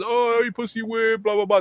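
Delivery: high-pitched taunting buddy voice; mocking jeer. Friends tease the reluctant biker, jeering high. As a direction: razz him in a high mock voice.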